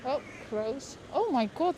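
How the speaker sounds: ordinary delivery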